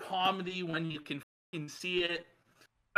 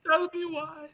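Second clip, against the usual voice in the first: higher put-on Indian accent; mock Indian accent; 6 semitones higher than usual. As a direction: needle him in a fake Indian accent. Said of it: "No one finds it funny".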